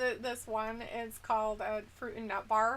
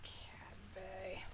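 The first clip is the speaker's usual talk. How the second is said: breathy whisper of Cadbury, no voice; 3 semitones lower, 17 dB quieter, much slower than usual. Shown as a demonstration. Streamer whispering the brand name to chat. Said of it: "You gotta say it with an English accent".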